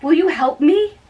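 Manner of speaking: usual speaking voice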